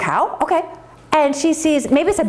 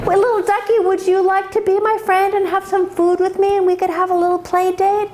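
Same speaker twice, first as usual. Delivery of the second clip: high, sweet calf voice; little calf; 7 semitones higher than usual. Acted. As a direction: invite a playmate in a high calf voice. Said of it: "and she says".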